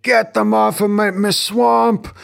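put-on high-pitched, indignant Scottish accent; mock Scottish ogre outburst; Scottish Shrek. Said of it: "I said I wouldn't do the Scottish accent".

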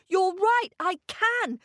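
put-on high, eager boy's voice; excited boy. A narrator's reading. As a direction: agree with bursting excitement. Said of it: "jumping almost out of his boots".

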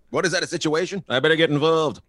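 high, loud meddler voice; mock busybody. Comic mimicking a parent who meddles, mock busybody. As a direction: imitate someone eager to butt in. Mimics someone eager to butt in.

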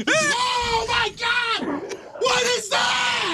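startled slow shout, spraying spit; real shout from a sudden sting; spit take. Real, not acted. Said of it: "You just spit all over me".

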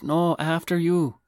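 high, slow mock-courteous voice; exaggerated high politeness; overly polite man. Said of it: "The man goes".

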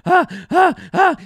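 high, spaced-out "ha" gasps; worn-out fighting cries. Retold or imitated. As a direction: imitate a fighter too tired to keep chopping. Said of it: "keeps getting tired until he wears himself out".

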